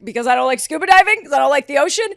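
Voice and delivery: loud, high, outraged mock disbelief; incredulous. Girlfriend challenging ex's absurd breakup excuse, incredulous.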